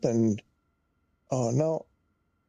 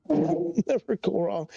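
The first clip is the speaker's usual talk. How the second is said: laughing; 10 semitones higher than usual. Real, not acted. Laughing at own advice.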